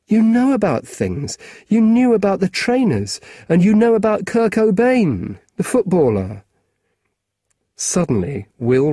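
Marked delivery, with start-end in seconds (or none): 0.1-6.4 put-on higher, eager young boy; lightly voiced keen schoolboy; a boy asking a grown-up a favour; boy's voice.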